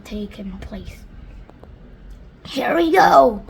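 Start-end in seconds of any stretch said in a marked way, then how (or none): 2.5-3.4 loud excited yell; shouted. Acted. Grasshopper shouting as the peach heaves, loud excited yell. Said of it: "shouted the Wolverine Grasshopper".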